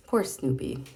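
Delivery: ordinary delivery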